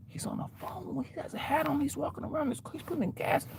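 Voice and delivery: high, whispered play-by-play; hushed narration. Retold or imitated. Bystanders narrate a man at the gas station, high and hushed. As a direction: whisper every move he makes.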